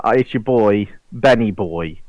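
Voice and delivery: ordinary talk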